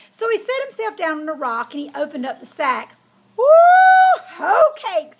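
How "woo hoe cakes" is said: high, loud happy squeal over his food; excited squeal. A narrator's reading. Hungry pig finding his food, loud excited squeal. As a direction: squeal with delight at the hoe cakes. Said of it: "he squealed".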